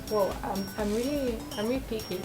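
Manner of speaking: ordinary talk, nothing marked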